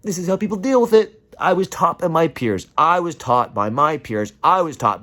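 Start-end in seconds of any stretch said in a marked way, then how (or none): 0.0-1.2 mocking silent type. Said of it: "go into silent mode to say".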